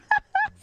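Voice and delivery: very high giggling squeal; squealing laugh. Real, not acted. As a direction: let out a shrill squeal of laughter.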